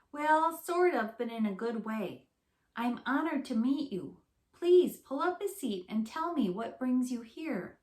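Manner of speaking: bright little critter, pleased to meet you; a cheerful skunk greeting her famous guests; friendly skunk voice. Acted.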